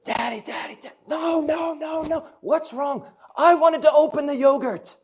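put-on high, whiny little girl protest; toddler's fit. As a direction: throw a tantrum like a small daughter. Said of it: "And she throws a fit".

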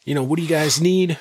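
sales voice. Retold or imitated. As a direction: greet customers like an eager rep. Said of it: "I put my sales voice on".

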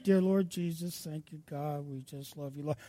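very soft, low, level murmur; mock half-hearted hushed prayer; meek mumbled prayer. Shown as a demonstration. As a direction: pray it timidly, as if not to be heard. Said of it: "They weren't saying".

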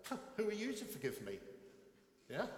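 a listener offended by the forgiveness; indignant retort. Retold or imitated. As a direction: bristle at being forgiven. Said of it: "when people say".

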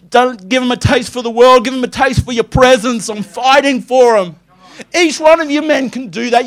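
high, loud impassioned preaching; fervent prayer. Acted. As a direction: pray over them with fire. Prays over his daughters fervently.

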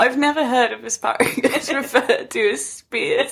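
breaking into giggles mid-sentence; real laughing. Real, not acted. Breaking off the article to comment, laughing. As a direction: say it through rising laughter.

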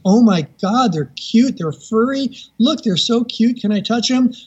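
high-pitched imitation of a delighted onlooker; excited gushing. Seatmate seeing the hidden gliders, gushing. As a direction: gush over something tiny and furry.

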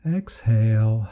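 normal talking voice

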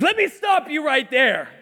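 put-on cocky kid interrupting. Son interrupting a man at church, cocky mock objection. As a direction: cut in as a self-assured kid. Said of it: "my son Jacob's like".

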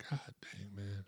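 breathy whispered exasperation; whispered curse. Real, not acted. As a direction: hush it, frustrated. Muttered frustration under breath.

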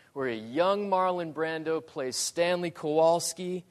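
normal talking voice

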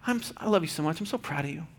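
put-on soft, loving words to your kid; tender to a child. Shown as a demonstration. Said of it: "you need to tell your kid".

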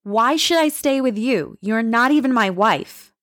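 a mild retold firm protest. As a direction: push back, firm and indignant. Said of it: "In a firm voice, I protested".

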